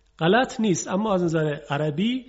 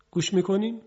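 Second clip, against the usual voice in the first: ordinary delivery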